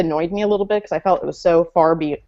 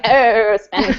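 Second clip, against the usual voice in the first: hammed-up actress impression breaking into a laugh; Katharine Hepburn; 3 semitones higher, 4 dB louder, slower than usual. Joking about the failed accent, in a mock Katharine Hepburn voice. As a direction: play up a bad celebrity imitation. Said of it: "it sounded a little bit like Katharine Hepburn".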